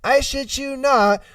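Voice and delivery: usual speaking voice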